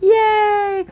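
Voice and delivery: very high, loud happy squeal; toddler cheer. Retold or imitated. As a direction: cheer like a toddler clapping his hands. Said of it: "He's like".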